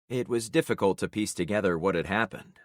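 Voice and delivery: plain reading voice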